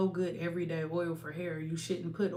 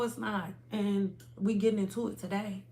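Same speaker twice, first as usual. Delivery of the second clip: normal talking voice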